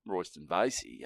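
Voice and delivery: ordinary talk, nothing marked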